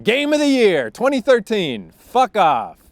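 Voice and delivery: high, loud mock fanfare then a scornful jab; sarcastic outburst. Real, not acted. Mocking the award.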